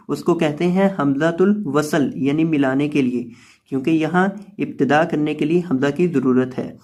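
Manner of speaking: usual speaking voice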